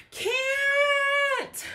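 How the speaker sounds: very high, loud shout; shrill cry. Acted. Kevin screaming as the tree comes down. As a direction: scream it as you fall.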